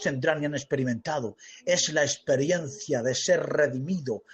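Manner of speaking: low, hushed sermon questions; intimate preaching. Real, not acted. Intimate reflective part of sermon.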